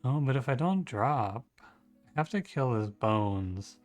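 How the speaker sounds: normal talking voice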